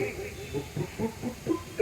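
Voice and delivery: short voiceless puffs of gurgling water; imitated pouring sound. Narrator showing how little sound the pot made, imitated pouring puffs. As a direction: puff out the small sound of water poured. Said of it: "एवढाच आवाज आला".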